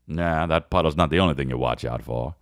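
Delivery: low, lazy leading-man reply; fifties movie man. Retold or imitated. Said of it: "there's a whole way of talking".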